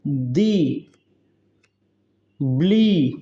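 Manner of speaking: दी and ब्ली each hit apart with weight; stressed syllables. Shown as a demonstration. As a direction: beat out दी then ब्ली with stress. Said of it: "दी भी हम जोर से पढ़ रहे हैं".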